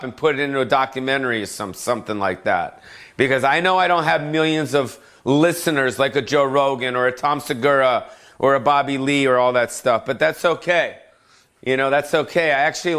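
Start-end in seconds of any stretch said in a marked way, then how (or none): none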